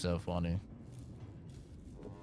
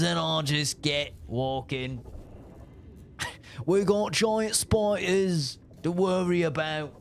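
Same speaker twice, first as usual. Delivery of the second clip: slow, higher, hammed-up Aussie boast; Australian accent; 5 semitones higher, slower than usual. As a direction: drag it out like a proud Aussie bloke. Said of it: "Australian's like".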